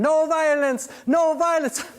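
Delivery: high, loud imitated screaming; mock scream. [name] retelling the kitchen fight to Jamal, mock screaming. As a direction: yell it like the guy pinned on the floor. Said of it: "dude's on the ground screaming".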